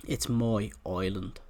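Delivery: a hammed-up Irish accent, "my island" said as "moy oiland"; quoting the Braveheart dude in a mock Irish accent; bad Irish accent. Minecraft player quoting Braveheart, mock Irish accent. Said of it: "the best Irish accent you're ever gonna hear".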